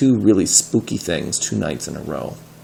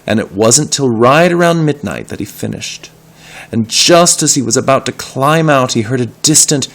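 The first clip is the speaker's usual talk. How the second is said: building suspense; 5 semitones higher, 7 dB louder than usual. A narrator's reading.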